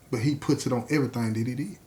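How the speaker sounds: plain reading voice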